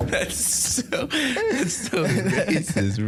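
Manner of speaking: high, drawn-out words wheezed through laughter; laughing hard. Real, not acted. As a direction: gasp it out while cracking up. Cracks up at the retold joke.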